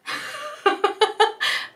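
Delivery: long high giggle. Real, not acted. Laughs before the reveal.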